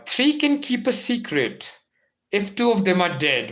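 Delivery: theatrical quote delivery; dramatic recitation. Shown as a demonstration. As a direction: present it with a flourish. Declaiming quote opening.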